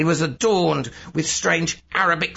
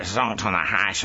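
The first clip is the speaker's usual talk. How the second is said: ordinary delivery, nothing marked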